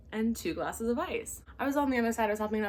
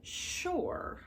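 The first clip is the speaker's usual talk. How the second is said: sarcastic sure; 8 semitones higher, 7 dB quieter than usual. Retold or imitated. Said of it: "And I was like".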